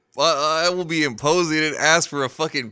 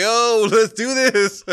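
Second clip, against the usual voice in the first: much higher and louder, slow and eager; mock sudden hype; 9 semitones higher, 7 dB louder, slower than usual. Friend jolting awake at the bar, eager and loud. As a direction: snap awake all fired up, hammed-up. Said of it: "snap out of it and be like".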